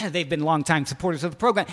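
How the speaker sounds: ordinary talk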